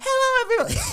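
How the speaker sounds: hammed-up falsetto hello to everyone; chirpy meeting voice. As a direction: squeal it like an overeager coworker. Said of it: "I'm gonna talk to my coworkers in a meeting" voice".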